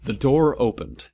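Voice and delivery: usual speaking voice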